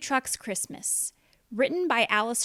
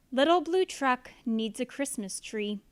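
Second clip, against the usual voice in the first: usual speaking voice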